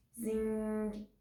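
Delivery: long, held buzzing ring; imitated doorbell. Girl rings at the footballer's door, imitated doorbell. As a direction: sound out a doorbell ringing.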